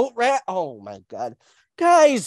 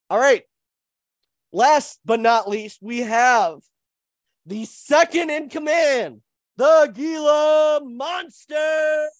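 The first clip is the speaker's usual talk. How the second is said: loud, slow, higher ring call; announcer shout; 6 semitones higher, 4 dB louder, slower than usual. Acted. Host announcing the second in command, loud drawn-out shout. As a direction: belt out the intro to the crowd.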